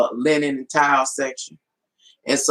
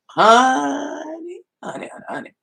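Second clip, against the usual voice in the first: gloating honey; 10 semitones higher than usual. Real, not acted. Gloating about clearance towels.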